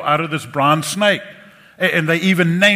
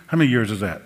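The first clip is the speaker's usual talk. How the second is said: ordinary delivery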